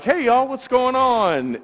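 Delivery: high, slow, friendly hello; cheery greeting. Retold or imitated. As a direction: greet the girls with a bright lift. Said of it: "And I'm like".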